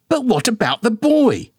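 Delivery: high-pitched, huffy mother voice; indignant mama. A narrator's reading. Mama bickering with daddy about his bald spot, high and huffy. Mama turns back to boy.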